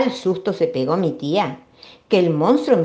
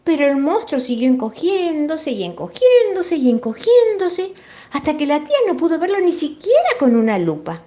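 rising wonder; 9 semitones higher, 3 dB louder than usual. A narrator's reading. Shrinking monster, voice rises.

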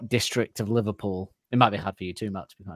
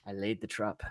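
ordinary delivery

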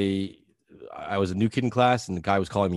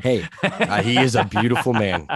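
louder, said through laughter; laughing agreement; 4 dB louder than usual. Real, not acted. Host agreeing with guest about a handsome player, laughing.

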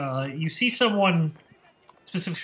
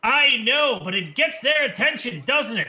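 higher, louder huckster pitching his tonic; snake-oil salesman; 9 semitones higher, 6 dB louder than usual. Acted. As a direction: sell it like a carnival quack hawking a cure. Salesman teases the crowd.

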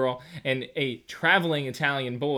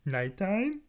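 mock sing-song; 14 semitones higher, much slower than usual. Mock BBC show imitation.

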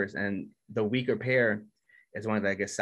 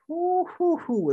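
much higher and louder hype whoops; excited whoop; 6 dB louder, much slower than usual. Real, not acted. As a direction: cheer like the fight is on now. Hyping the upcoming episode.

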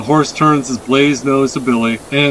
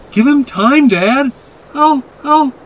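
put-on high, urgent child's voice; a boy's raised, high plea; pleading boy; 13 semitones higher, 5 dB louder, slower than usual. A narrator's reading. Boy defends the horse to his dad, pleading.

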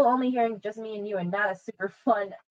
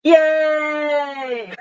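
excited cheer; 7 semitones higher, 8 dB louder than usual. Real, not acted. Celebrating their improv.

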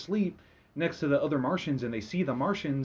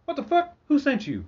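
much higher, shocked outburst; startled Martian; 11 semitones higher, 3 dB louder than usual. Retold or imitated. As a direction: squawk in disbelief. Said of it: "he's like".

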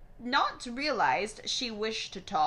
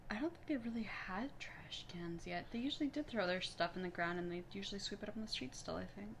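whispering; 13 dB quieter, much faster than usual. Real, not acted. Quiet aside during reading.

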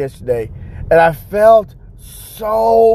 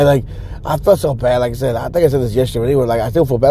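usual speaking voice

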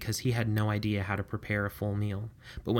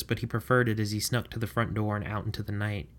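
ordinary delivery, nothing marked

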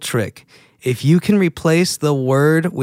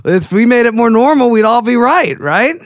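excited; 8 semitones higher, 6 dB louder than usual. Real, not acted. Excited plea for proper grammar.

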